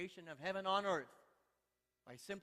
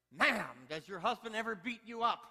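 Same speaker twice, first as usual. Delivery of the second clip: loud hammed-up demand; acted judge at wit's end; exasperated judge; 5 semitones higher, 9 dB louder than usual. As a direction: hit "Ma'am" hard, then press the question. Exasperated judge questioning in joke.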